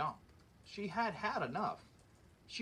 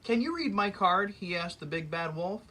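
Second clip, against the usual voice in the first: lively read-aloud storybook voice; story narration; 5 dB louder than usual. A narrator's reading. Reading a fairy tale to children, lively storyteller voice. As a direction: read it like story time for kids.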